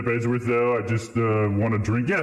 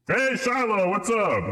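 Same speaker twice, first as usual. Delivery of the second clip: bright high hello; high greeting; 11 semitones higher, slower than usual. Real, not acted. Streamer greeting viewer Shiloh with a high hello.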